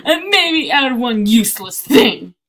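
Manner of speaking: loud, high, half-sung shout; belted comment reading. Pretend. Streamer belting out a player's trade comment. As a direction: belt out the chat message like an opera. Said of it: "how about we read people's comments".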